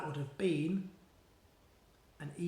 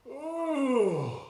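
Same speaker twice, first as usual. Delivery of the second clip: higher disgusted groan; disgusted ugh; 7 semitones higher, 4 dB louder than usual. A narrator's reading. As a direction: recoil from the stench. Smelling the giant's breath.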